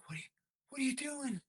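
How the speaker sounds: high, hushed and panicked; a frantic under-the-breath aside; alarmed hush. Retold or imitated. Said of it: "And I'm like".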